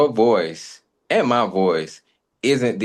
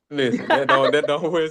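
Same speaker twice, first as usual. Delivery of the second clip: laughing; 6 semitones higher than usual. Real, not acted. Laughing denial of seductive voice.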